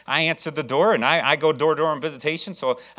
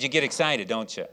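ordinary talk

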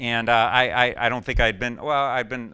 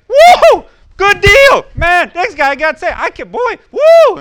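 shouted whoops, much higher and louder, laughing; excited whooping; 19 dB louder, slower than usual. Retold or imitated. Preacher reliving the ride home after a conversion, whooping. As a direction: yell for joy like a fan. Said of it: "but I was like".

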